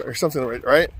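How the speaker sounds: ordinary delivery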